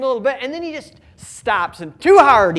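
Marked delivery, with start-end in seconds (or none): 2.0-2.6 put-on loud, high whine of a toddler giving up; whiny toddler. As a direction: belt "Too hard" like a fed-up toddler. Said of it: "he says".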